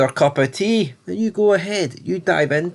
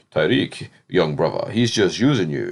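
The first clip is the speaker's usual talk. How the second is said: a hint of a solemn older guide, voiced lower; mock mentor voice; 5 semitones lower than usual. Mocking the RSJ guy lecturing Tyreek in a low preachy voice.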